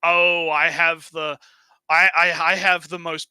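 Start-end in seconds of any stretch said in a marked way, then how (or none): none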